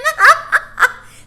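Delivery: high, loud cackling bird laugh; roaring bird laugh. A narrator's reading. Starling laughing at the twins, loud high cackle. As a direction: roar with mirth like a starling. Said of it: "roared with mirth".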